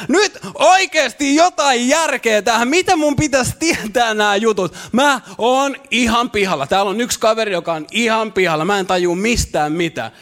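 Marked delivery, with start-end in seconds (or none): 0.1-6.7 frustrated outcry. Retold or imitated. Churchgoer cries out at the pastor, exasperated and louder. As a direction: cry out that nobody explained anything. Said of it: "nousta seisomaan ja huutaa".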